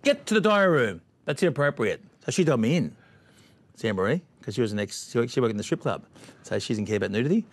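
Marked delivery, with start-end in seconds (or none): 0.0-2.0 higher, louder stern summons; mock Big Brother order. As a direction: bark his name like a show producer. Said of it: "They said".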